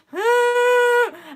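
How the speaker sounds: high, loud shout of laughter. Real, not acted. Vlogger laughing off her struggle, high and loud. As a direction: laugh it off loudly. Laughs after tearful quote.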